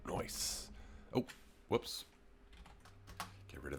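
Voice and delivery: whispered. Real, not acted. Soft aside.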